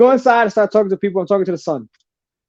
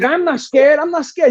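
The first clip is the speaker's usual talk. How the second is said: pitched-up, insistent protest; retold defiant insistence; 7 semitones higher than usual. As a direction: insist on it, acting out the bravado. Retold defiance before the tigers.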